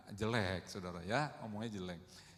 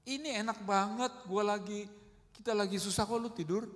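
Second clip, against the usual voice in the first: higher-pitched mock indignation; acted angry grumbling; 6 semitones higher than usual. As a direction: complain as the disciples resenting a sleeper. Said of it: "Sebenarnya murid-murid itu marah". (gerutuan kesal murid, diperagakan bernada tinggi)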